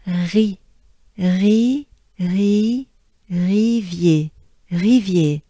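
"Ri" building to "Rivier" on a French R; careful syllable drill, "Ri" then "Rivier". Shown as a demonstration. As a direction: exhale the back-of-mouth R in "Rivier". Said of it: "Take a good breath first".

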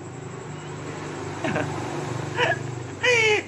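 a shrill airless cackle, very high; high breathy mocking laugh. Real, not acted. A streamer taunting online critics, high airless cackle. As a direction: cackle at them, mostly breath.

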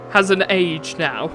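ordinary talk, nothing marked

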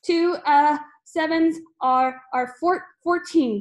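loud, high, halting pupil; gasped answer. A narrator's reading. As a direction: stammer out the answer under duress. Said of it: "gasped Rupert".

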